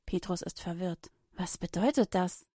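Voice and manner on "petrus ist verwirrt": ordinary delivery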